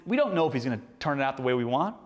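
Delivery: ordinary talk, nothing marked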